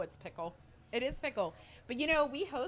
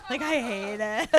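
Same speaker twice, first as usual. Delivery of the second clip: laughing; 5 semitones higher, 6 dB louder than usual. Real, not acted. Laughing about being blindfolded.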